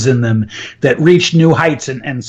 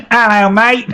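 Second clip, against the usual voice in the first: mock Aussie accent; 5 semitones higher than usual. Coach teasing an Australian listener, in a mock Aussie accent. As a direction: put on a playful Aussie twang. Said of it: "with the Aussie accent, it's even better".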